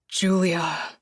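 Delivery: low, breathy and begging; low breathy plea. A narrator's reading. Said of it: "in a low, breathy voice like he's begging me".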